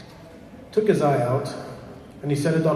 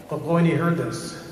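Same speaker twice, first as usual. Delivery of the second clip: usual speaking voice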